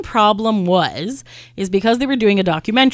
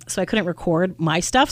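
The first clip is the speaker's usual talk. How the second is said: ordinary delivery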